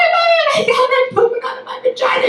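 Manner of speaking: put-on high, fast, squealing child voice; little girl panic. Daughter panicking on the toilet, high fast squeal. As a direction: squeal it like a shocked four-year-old. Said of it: "She's like".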